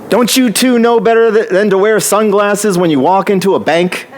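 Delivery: high-pitched mock scolding yell; retold yell. Guard scolding two customers in sunglasses at the bank, mock yell. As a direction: imitate an indignant guard telling people off. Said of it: "he yelled at both of us and said".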